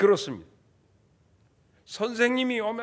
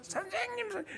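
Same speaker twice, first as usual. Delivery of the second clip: much higher, soft crush voice; gushing schoolgirl; 11 semitones higher, 11 dB quieter than usual. Retold or imitated. Preacher imitating a schoolgirl swooning over a teacher, high soft. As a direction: coo at the teacher like a smitten schoolgirl. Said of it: "여학교의 총각 선생 좋아하듯이".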